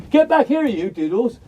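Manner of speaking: high, loud calling shout; Sergeant Major shout. Real, not acted. Owner calling the dog back from next door, shouting. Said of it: "my voice went up into the Sergeant Major zone".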